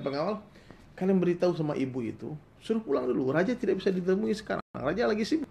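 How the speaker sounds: high, loud royal brush-off; lightly voiced arrogant young king waving the guard off; the proud king sends his mother away; haughty king. King dismisses mother via guard.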